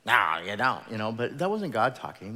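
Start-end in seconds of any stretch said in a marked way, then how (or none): 0.0-0.8 louder, a hint of a scolding Sunday school teacher; stern teacher. Teacher scolding a doubting child, lightly voiced loud rebuke. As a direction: snap it back like a scold. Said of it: "That was my third grade Sunday school teacher".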